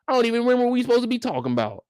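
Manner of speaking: fast tumbling rant; rushing. Acted. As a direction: race through it. Rushed rant.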